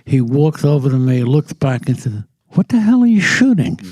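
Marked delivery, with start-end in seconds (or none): 2.5-3.8 high, loud mock confrontation; indignant challenge. Stranger challenging the photographer who shot him, indignant. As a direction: imitate an affronted passer-by.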